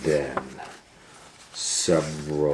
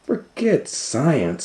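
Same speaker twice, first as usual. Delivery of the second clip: scheming doctor; 9 semitones higher, slower than usual. Acted. Varmint voiced.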